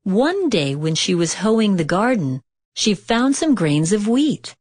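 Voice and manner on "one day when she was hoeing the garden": plain reading voice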